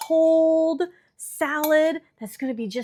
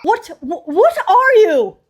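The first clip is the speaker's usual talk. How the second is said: loud, high, slow barked question; shouting at a cook on a cooking show; mock Gordon Ramsay yell; 8 semitones higher, 13 dB louder, slower than usual. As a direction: bellow it like an angry TV chef.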